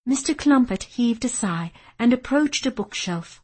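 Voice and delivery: usual speaking voice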